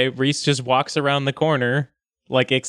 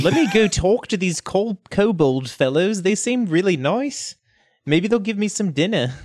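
friendly, lilting character voice; eager lizardfolk Reese; 6 semitones higher than usual. Acted. Reese eager to meet kobolds for dinner, friendly lilting voice. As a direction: play an easygoing, keen lizardfolk.

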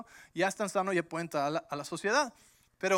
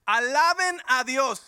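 louder, slow, high cry of praise; exclaimed praise; 5 semitones higher, 9 dB louder, slower than usual. Acted. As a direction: lift the words up in exultant praise. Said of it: "volvió a Jesús y exclamó". (exclamación de alabanza, fuerte y lenta)

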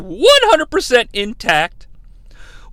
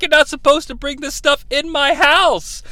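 mock angry wife; 6 semitones higher, 3 dB louder than usual. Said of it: "she's like".

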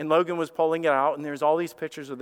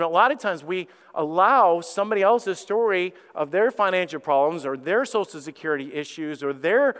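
usual speaking voice

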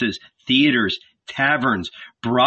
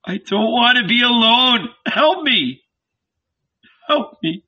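weeping, begging for help; weeping plea; 10 semitones higher than usual. A narrator's reading. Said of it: "began to weep".

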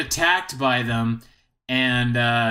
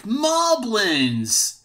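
high, drawn-out exclamation; mock alarmed cry; 9 semitones higher than usual. Link spots an enemy, high mock cry. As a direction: imitate a hero crying out on spotting an enemy.

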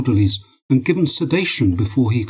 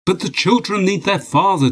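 high-pitched, urgent protest; blurted; 9 semitones higher than usual. A narrator's reading. Husband protesting as his wife threatens to leave, blurted. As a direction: burst out with the objection. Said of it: "Dmitri blurted".

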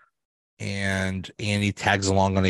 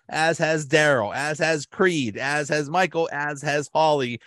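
raised, proud listing voice; Dwight voice; 6 semitones higher than usual. Retold or imitated. Dwight announces to the office, higher proud listing. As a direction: list them off with stiff pride. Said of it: "Dwight says".

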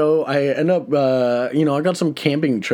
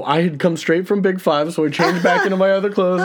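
ordinary talk, nothing marked

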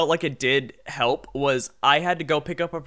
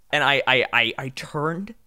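ordinary delivery

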